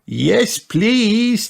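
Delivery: high-pitched eager cheer. A narrator's reading. Grandchildren accepting Granny's baking offer, high cheer. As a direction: cheer like two keen little pigs. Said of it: "cheered Peppa and George".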